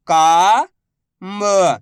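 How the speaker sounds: very slow, each syllable drawn out. Shown as a demonstration. Teacher sounding out a word for pupils, slow syllables. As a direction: spell the word out slowly for a child. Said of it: "मैं किस तरीके से".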